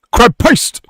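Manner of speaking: high, loud "Crab paste!" in a northern accent. Shown as a demonstration. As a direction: yell it like a northern market trader. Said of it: "Andy's buzzer sound is".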